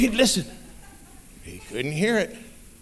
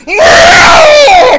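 huge high shout; booming bear roar; bear hollers; 17 dB louder than usual. Acted. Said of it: "A big old bear hollers out".

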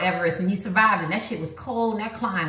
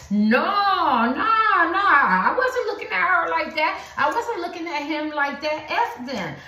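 defensive denial; 7 semitones higher, slower than usual. Acted. Voicing the partner's denial.

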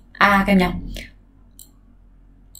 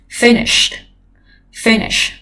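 FIN stressed in finish; weight on fin, light -ish; stress on first syllable of finish; 2 semitones higher, much slower than usual. Shown as a demonstration. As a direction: model finish stressed up front. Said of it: "Nó là trọng âm một".

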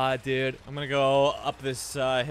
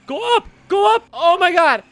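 high, loud yelling at the car; frantic high shout; panicked shout; 13 dB louder than usual. Real, not acted. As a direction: scream at the wheel to climb. Fleeing police in a car.

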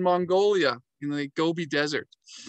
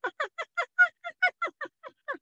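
high yelping hyena cackle imitation; hyena laughing call. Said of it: "a pack of these hyenas going".

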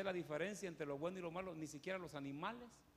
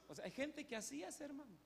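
usual speaking voice

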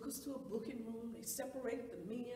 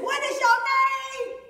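high, drawn-out full-volume bellow; shouted demand; 11 semitones higher, 17 dB louder, slower than usual. Acted. Officer questioning the woman he is beating, shouted demand.

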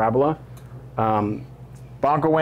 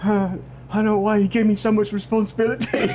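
put-on high, fumbling, halting kid voice; a flustered schoolboy searching for words; nervous kid voice; 11 semitones higher than usual. Georgie keeps talking.

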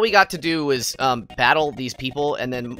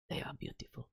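whispered, barely audible praise; reverent whisper; 21 dB quieter than usual. Pretend. Streamer admires a favorite band, reverent whisper.

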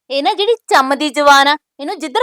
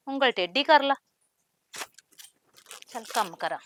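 low, much softer grumbling to herself; muttering aside; 3 semitones lower, 11 dB quieter than usual. Acted. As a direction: mutter it alone after she's gone. Alone, muttering after the fight.

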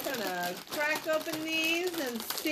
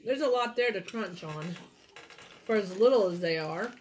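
talking with mouth partly full; words around a chip; chewing while talking; 5 semitones lower, faster than usual. Real, not acted. Seen 1: Woman on right ate a chip just before; her jaw still seems chewing.

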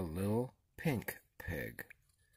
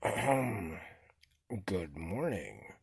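chief frog; 4 semitones lower than usual. A narrator's reading. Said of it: "chief frog spoke up".

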